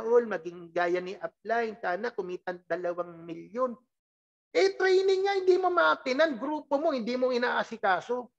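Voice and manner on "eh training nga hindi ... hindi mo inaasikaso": exasperated rebuke that settles down; scolding. Real, not acted. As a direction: scold the lazy members. Scolding members who skip training.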